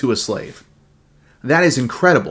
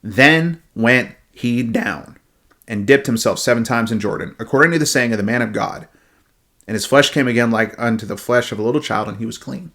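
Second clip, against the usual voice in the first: scripture reading; 4 semitones lower than usual. Acted.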